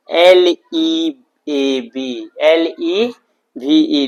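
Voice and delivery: letter by letter, each one drawn out; slow spelling out. Shown as a demonstration. Teacher spelling "leave" for students, slow separate letters. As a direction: spell it loudly and slowly for learners.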